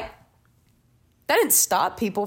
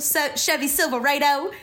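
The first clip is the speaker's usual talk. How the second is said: a light mock ad-announcer voice, higher; commercial parody voice; 4 semitones higher than usual. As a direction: quote the truck ad like a cheesy announcer. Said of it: "that commercial?".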